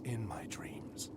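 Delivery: whispered vow of vengeance; menacing whisper. Acted. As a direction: breathe it as a slow threat. Threat against his enemies.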